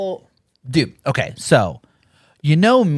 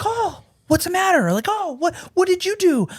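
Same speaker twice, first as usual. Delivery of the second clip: much higher, mock-worried voice; concerned coworker. Coworker reacting to bad news, mock concern. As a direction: play the nosy worried asker. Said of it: "then they're like".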